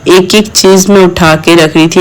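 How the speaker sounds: ordinary talk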